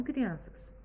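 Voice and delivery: usual speaking voice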